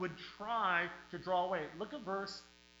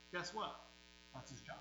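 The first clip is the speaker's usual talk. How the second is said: usual speaking voice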